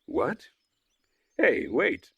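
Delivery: high, loud surprised call; hint of boy calling out; calling after. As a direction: shout after someone running off. Electronic calls after him.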